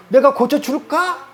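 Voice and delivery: Jesus offering, slow high rising 고쳐줄까; slow rising question. Shown as a demonstration. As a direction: stretch it out and lift 고쳐줄까 as a real question. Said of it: "물어봤기 때문에 대답을 하는 거예요".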